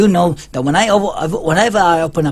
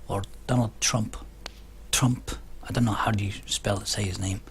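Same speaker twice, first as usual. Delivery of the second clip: whispered, much softer aside; hushed aside; 6 semitones lower, 11 dB quieter than usual. Real, not acted. Mocking Trump's name to viewers, whispered aside. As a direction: drop to a whisper around the name.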